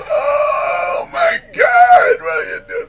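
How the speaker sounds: very high, strained, drawn-out mock squeal; squealing alternator. Poor alternator protests the heater and wipers, comic squeal. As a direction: squeal it slowly like an overloaded machine, then drop away. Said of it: "the poor alternator goes".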